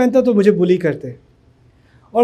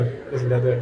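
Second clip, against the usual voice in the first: lower, laughing through the words; laughing naive reply; 9 semitones lower, 9 dB quieter, much slower than usual. Retold or imitated. Retelling his clueless answer to the robber, laughing. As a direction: laugh at your own innocence. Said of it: "مجھے English نہیں آتی تھی سر".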